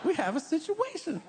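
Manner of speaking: very high, comic hushed alarm; mock alert. Comic reacts to trouble in theater, high mock alert. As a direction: announce trouble in a tiny high voice. Acted reaction to the mother's outburst.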